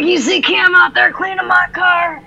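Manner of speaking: high, proud brag; smug son. Retold or imitated. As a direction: show off like a proud teen boy. Son proud, voiced by mom.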